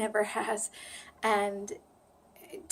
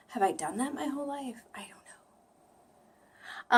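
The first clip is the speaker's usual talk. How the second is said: much softer, puzzled whisper; whispered aside; 4 semitones higher, 4 dB quieter, slower than usual. Real, not acted. Vlogger wondering to herself about her vowel, in a soft whisper. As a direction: mutter it to yourself, half lost. Bewildered aside after surprise.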